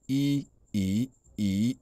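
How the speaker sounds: I level, then I rising, then I dipping; three drawn-out tone drills on I; first three tones. Shown as a demonstration. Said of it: "On a le premier ton".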